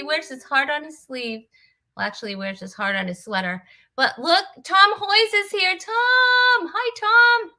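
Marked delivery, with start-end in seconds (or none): 4.0-7.5 high, loud, thrilled hello; excited greeting. Real, not acted. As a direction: burst out greeting a friend. Greeting a guest arriving live.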